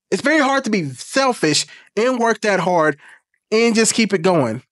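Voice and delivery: ordinary talk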